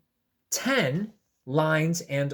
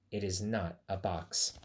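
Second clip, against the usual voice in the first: low bunny voice; 8 semitones lower, 8 dB quieter than usual. Acted. Bunny's reply, read aloud.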